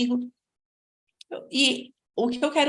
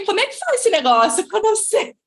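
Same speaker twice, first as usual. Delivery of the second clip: high, loud laughing outburst; comic dismay; 10 semitones higher, 7 dB louder than usual. Retold or imitated. Recalling the shock of working abroad, comic dismay. Acted alarm at having to work.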